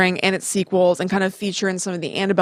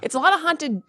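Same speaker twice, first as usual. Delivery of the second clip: normal talking voice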